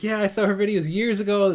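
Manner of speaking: high, loud, enthusiastic quote; imitated eager reply. Host quoting his friend about the singer, imitated eagerness. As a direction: voice a friend keenly confirming she's a fan.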